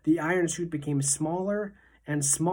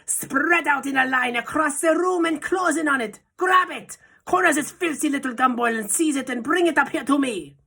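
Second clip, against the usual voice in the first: high, loud witch's commanding scream; Grand High Witch commanding; 10 semitones higher, 5 dB louder than usual. A narrator's reading. Witch leader screaming commands to trap the boy. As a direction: order the whole room to close in. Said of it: "screamed the Grand High Witch".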